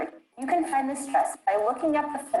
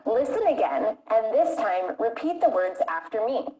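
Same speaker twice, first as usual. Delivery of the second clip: ordinary talk, nothing marked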